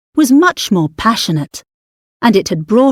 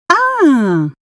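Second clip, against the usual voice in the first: sudden realisation; 6 semitones higher than usual. Acted. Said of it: "she said suddenly".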